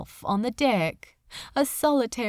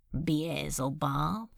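quiet wary question; 6 semitones lower, 6 dB quieter, slower than usual. Acted. Crowley answering the demon lord.

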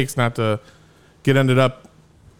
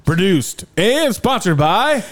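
announcer voice; 6 semitones higher, slower than usual. Pretend. Mock ad sign-off.

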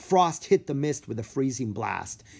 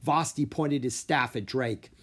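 plain reading voice

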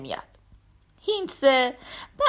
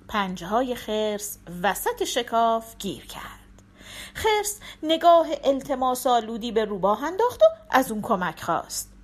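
ordinary delivery

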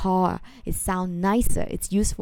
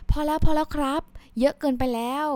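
higher, fast flustered "enough, enough"; polite refusal; 8 semitones higher, much faster than usual. Shown as a demonstration. Guest stopping a host piling on rice, flustered refusal. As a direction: wave off more rice on your plate. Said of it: "You say".

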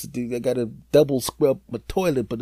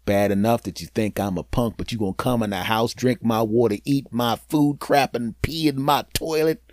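usual speaking voice

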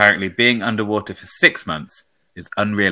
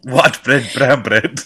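put-on South African accent; 4 semitones higher, 4 dB louder, slower than usual. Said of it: "South African accent".

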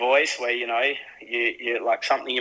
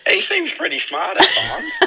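laughing through the words, pitched up high; 5 dB louder than usual. Real, not acted. Co-host laughing while defending Dad, laughing. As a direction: giggle it out.

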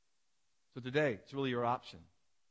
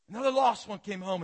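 raised voice settling down from a shout; easing-off exclamation; 7 semitones higher, 9 dB louder than usual. Acted. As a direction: repeat it loudly but less intensely, falling away. Repeats God's announcement, coming down.

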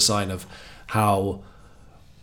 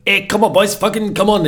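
imitating a French keeper's rallying cry, much higher and louder in a hammed-up French accent; mock French accent; 11 semitones higher, 7 dB louder than usual. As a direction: shout it like a goalkeeper geeing up his team, in a bad French accent. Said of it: "in his little French accent".

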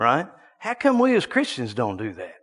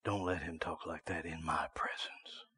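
hushed whisper; 8 semitones lower, 16 dB quieter than usual. Retold or imitated. Preacher recalling what the Spirit of God said, whispered. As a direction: repeat it barely above a breath. Spirit's words repeated in hush.

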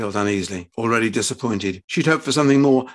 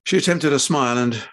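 usual speaking voice

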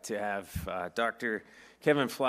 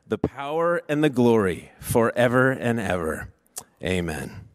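prayer voice; 4 dB louder than usual. Acted. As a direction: lead the church in reverent prayer. Pastor leads congregation in prayer.

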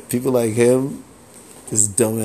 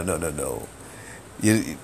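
ordinary talk

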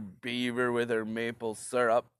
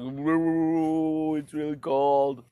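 mock Canadian; much slower than usual. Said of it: "us Canadians just gonna be like".

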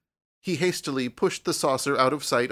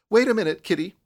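eager call; 7 semitones higher, 5 dB louder, faster than usual. Acted. Girl calls to kitten.

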